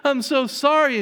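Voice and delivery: high, loud wailing cry of sorrow; acted weeping plea. Street woman weeping at the communion table, loud high wail. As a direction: wail it out like a broken woman begging forgiveness. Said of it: "just starts weeping".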